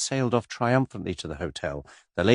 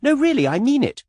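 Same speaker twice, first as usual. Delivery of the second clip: put-on much higher, girlish gushing voice; skittish young lady; 6 dB louder than usual. A narrator's reading. Miss Taylor pestering Mister Cade, high and gushing. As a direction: flutter up high like a giddy girl.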